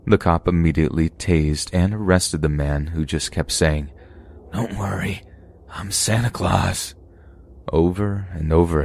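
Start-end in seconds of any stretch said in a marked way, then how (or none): 4.5-6.9 eerie calm. Acted. Deranged man repeating himself.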